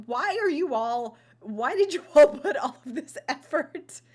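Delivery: loud, high exasperated outburst; mock screaming. Baffled viewer demanding answers from the cast, screaming. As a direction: build to a comic yell of disbelief. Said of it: "I'm screaming into the mic now".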